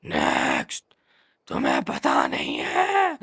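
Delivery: very low, croaking voice that loses its tone; soft, rasping mock manager voice; hoarse manager. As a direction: mimic a man whose throat has given out. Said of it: "इसका गला कैसे बैठ गया है".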